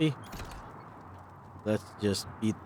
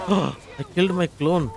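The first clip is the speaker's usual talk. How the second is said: shocked; 8 semitones higher, 6 dB louder than usual. Real, not acted. Shock at killing own clone.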